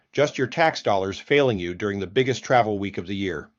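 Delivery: ordinary talk, nothing marked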